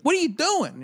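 high, loud, startled demand; a father confronting a stranger's child in the hot tub; outraged dad. Retold or imitated. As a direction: bark it at the kid in disbelief. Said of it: "my dad's like".

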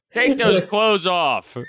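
hammed-up insult; mock taunt. Acting out insult.

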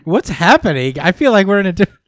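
loud, fast, laughing disbelief; laughing confusion. Real, not acted. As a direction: blurt it out through a laugh.

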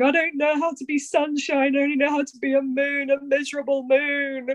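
high, mock-tearful whine, mildly imitated; retold crying. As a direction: replay the sob to the therapist, lightly. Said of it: "crying, I was going".

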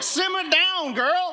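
high-pitched comic telling-off; mock scolding. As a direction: playfully hush an over-eager wife. Husband hushing wife, retold.

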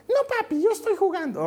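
put-on very high small-child protest; little girl. Small daughter brushing off her father, high child's voice.

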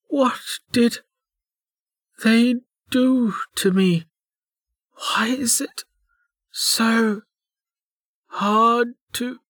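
high, slow, halting gasps; weak distress. A narrator's reading. Injured woman panting on the floor, weak distress. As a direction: struggle out each word, high and broken by pauses.

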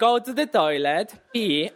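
normal talking voice

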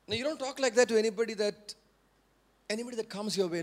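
ordinary talk

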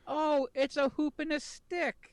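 high, slow mocking mom voice; sarcastic mother praising old toys; mom impression. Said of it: "I love your impression of your mom".

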